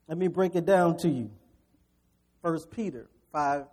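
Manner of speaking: usual speaking voice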